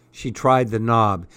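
plain reading voice

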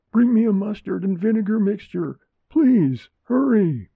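high, urgent plea for help; frantic shout. A narrator's reading. Frantic mother to watching neighbor, shouting. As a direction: call out desperately, pitched high. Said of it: "Esther shouted to her neighbor friend".

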